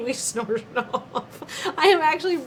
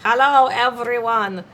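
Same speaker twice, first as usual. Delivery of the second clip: badly faked Latin American accent. Bandleader selling a mariachi act, fake Latin American accent. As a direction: pitch in a hammy, vague Latin American accent.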